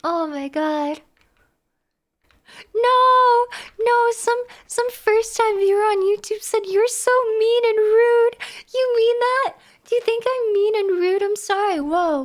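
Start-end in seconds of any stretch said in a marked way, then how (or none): 2.7-11.8 higher-pitched, indignant pleading; upset protest. Real, not acted. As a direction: protest the accusation in a raised voice. Protesting a viewer calling them mean.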